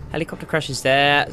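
ordinary talk

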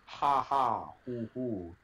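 ordinary delivery

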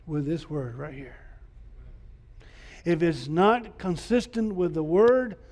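ordinary delivery

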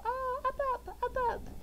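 put-on high little-kid voice saying up; imitating a toddler pointing at planes. Mother imitating her toddler son, high and childlike. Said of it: "he would go".

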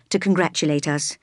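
ordinary delivery

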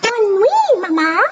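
a duckling saying goodnight to Jack; baby duck. Acted. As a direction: call it out sweetly. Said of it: "said the baby duck".

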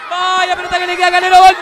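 rising fast excited commentary; building goal call. Real, not acted. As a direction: speed up into the shout.

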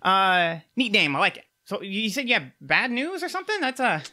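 eager little rock gnome, chirpy and quick; gnome inventor. Acted. GM keeps voicing the gnome.

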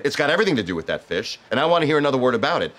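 rising angry rant, fast; building from firm to shouting. Acted. Louis escalating at Jeffrey.

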